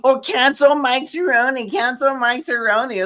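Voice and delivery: high-pitched mock campaigner; mocking imitation. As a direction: parrot her demand in a high mocking voice. Mocking woman's calls to cancel someone.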